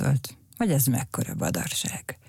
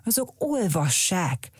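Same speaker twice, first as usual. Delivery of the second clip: talking back; 6 semitones higher than usual. A narrator's reading. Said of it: "feleselt Cirmacs".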